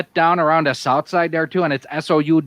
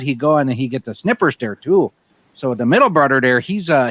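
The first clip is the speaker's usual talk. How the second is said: hammed-up Yooper Wisconsin accent, "you betcha there, eh?"; 2 semitones lower than usual. Bragging about deer camp to friends, Yooper accent.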